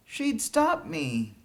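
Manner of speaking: put-on a boy's slow worried line. A narrator's reading. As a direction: say it slowly, anxiously. Boy worried about mother.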